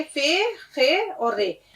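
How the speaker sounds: खे drawn slowly from the throat in फे, खे और रे; slow spelling out. Shown as a demonstration. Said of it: "हलक से थोड़ा सा निकालने की कोशिश करिए". (फे, खे और रे धीरे धीरे, खे हलक से)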